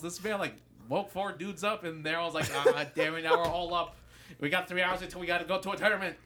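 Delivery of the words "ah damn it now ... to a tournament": high, loud and fast; mock panic over lost sleep; frustrated panic. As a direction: rattle it off in a panic. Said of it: "I'm panicking".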